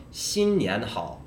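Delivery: 新年好 drawn out slowly, each tone distinct; practicing tones. Shown as a demonstration. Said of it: "First tone, second tone, third tone".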